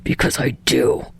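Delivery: low, soft growl; low gruff growl. Acted. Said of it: "Bakugo growls".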